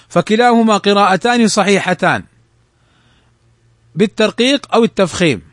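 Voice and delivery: usual speaking voice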